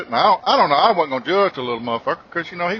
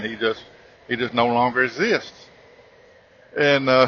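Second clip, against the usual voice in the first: ordinary talk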